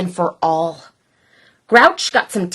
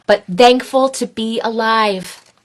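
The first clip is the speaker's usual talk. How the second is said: ordinary delivery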